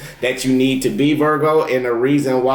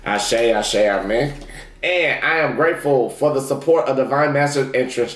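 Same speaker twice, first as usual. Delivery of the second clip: ordinary talk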